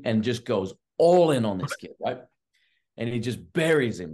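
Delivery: ordinary delivery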